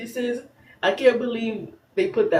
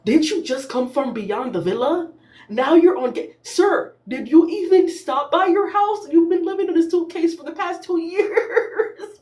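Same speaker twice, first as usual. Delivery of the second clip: exasperated rant; 7 semitones higher than usual. Retold or imitated. Yelling at Kendall on the TV screen, high exasperated scolding. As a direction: scold him like he should know better. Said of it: "I said".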